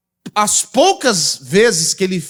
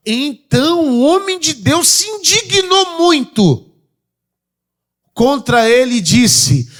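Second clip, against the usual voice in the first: higher, louder preaching on "indignou"; building preaching; 6 semitones higher, 4 dB louder than usual. Acted. Preacher tells the church of the man of God's anger, rising and louder. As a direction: rise up as the story heats.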